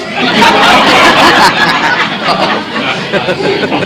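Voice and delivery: a long breathy laugh at his own joke; hearty laugh. Real, not acted. Preacher laughing at his own joke to the congregation, hearty laugh. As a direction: let the laugh roll out freely.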